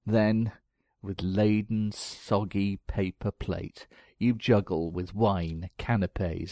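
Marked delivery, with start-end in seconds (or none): none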